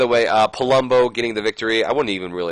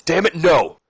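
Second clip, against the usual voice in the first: louder, higher retold protest; frustrated outburst; 8 semitones higher, 5 dB louder than usual. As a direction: snap back at Shane in frustration. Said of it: "getting a little bit more frustrated".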